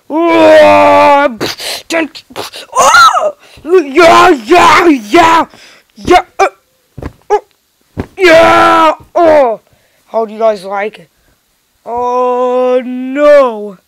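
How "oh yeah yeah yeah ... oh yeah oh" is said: louder, higher whoops; excited cheering. Pretend. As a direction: cheer wildly while dancing. Wild basketball dance cheering.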